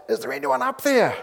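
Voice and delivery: high-pitched panicked cry for help; acted frightened shout, pitched high; terrified calling out. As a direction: call upward in hammed-up terror. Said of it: "Filled with terror, he called out".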